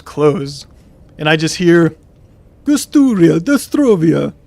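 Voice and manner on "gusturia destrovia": louder, higher mock Russian accent, jumbled words. Overheard stranger in the next room, mock Russian accent. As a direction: fake Russian-sounding gibberish, called out. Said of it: "I hear, like, a Russian accent".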